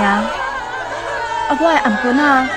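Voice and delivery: ordinary talk, nothing marked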